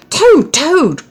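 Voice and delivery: high, loud calling of the name; cried out. A narrator's reading. Frog crying out to Toad through the door. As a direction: cry out for him. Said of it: "cried Frog".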